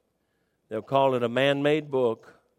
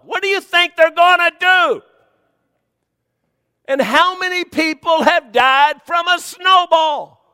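high, loud, slow rhetorical shout; incredulous shout; 14 semitones higher, 11 dB louder than usual. Acted. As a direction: hammer home the absurdity of the rule. Preacher mocking an absurd rule.

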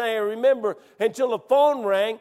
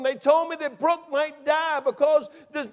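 normal talking voice

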